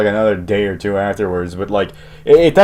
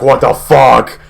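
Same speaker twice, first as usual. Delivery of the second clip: mildly raised mock scream; retold scream; 4 dB louder, slower than usual. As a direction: imitate mom yelling, only half as loud. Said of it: "wailing and screaming".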